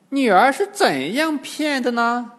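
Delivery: high old demoness acting amazed; feigned surprise. A narrator's reading. Old demoness asking her daughter, feigned surprise. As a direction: ask in high mock astonishment. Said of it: "金蝉老妖假装吃惊".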